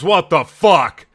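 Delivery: slow, loud, high shocked yell. Retold or imitated. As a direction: shout it in horrified disbelief. Kid sees kitchen of frogs.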